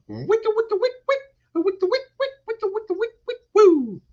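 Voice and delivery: very high vocal record scratch wick wick; squeaky turntable scratch ending in woo; scratch noise. Pretend. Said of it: "record scratch noise".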